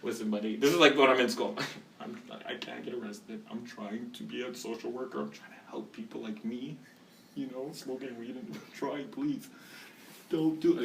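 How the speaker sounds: a quiet, mock-desperate plea to a cop; hushed pleading. Begging the cop, retold.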